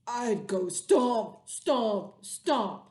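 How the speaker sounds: hammed-up angry stomping; mad monster stomp. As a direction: stomp out each word like a cross monster. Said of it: "if I were a mad monster".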